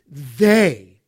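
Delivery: "they" loud, low and set apart; emphatic THEY. Real, not acted. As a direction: hit "they" hard as a word on its own. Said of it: "emphatic personal pronouns".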